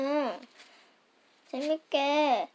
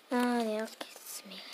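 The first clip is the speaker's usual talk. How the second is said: whisper; 3 semitones lower, 6 dB quieter, much faster than usual. Real, not acted. Hushed quick aside.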